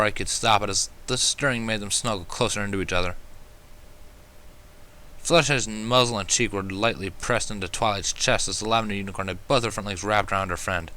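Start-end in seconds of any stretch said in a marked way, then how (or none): none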